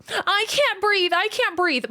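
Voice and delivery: high, slow, whiny "I can't breathe" in mockery; mock the family members' fake gasping, high and drawn out; whiny mock complaint. Streamer mocking maskless relatives, high and whiny. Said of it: "were like".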